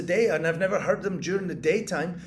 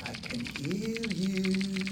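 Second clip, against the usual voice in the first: menacing whisper; 6 dB quieter, much slower than usual. Acted. Stalking rats in the wall.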